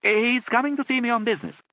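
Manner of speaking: loud and high; loudly. A narrator's reading. Lord Charles speaking up to his deaf aunt, loud. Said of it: "he added loudly".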